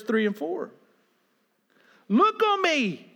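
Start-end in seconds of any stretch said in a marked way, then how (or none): 2.1-3.1 pleading. Retold or imitated. Psalmist pleading with God.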